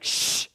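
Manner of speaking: long drawn-out shh; hushing hiss, held; library shush. Pretend. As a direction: shush a noisy neighbour. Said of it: "if you're in a, in a library".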